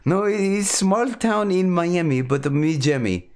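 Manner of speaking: high, slow put-on Eastern European accent; mock Transylvanian accent. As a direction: draw out a silly Transylvanian accent. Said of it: "like, Ukrainian or Transylvanian".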